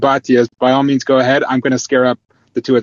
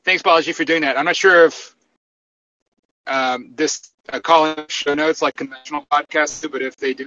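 normal talking voice

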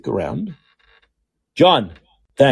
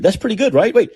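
ordinary talk

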